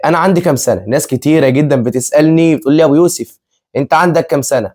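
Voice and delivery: ordinary talk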